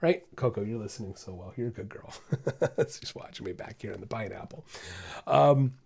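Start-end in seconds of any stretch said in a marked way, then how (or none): 0.4-2.1 soft, lower aside to the dog Coco; softly praising the dog off to the side; quiet praise to dog. Real, not acted. 2.9-4.6 much softer, lower aside; softly remarking on the dog; quiet aside. Real, not acted.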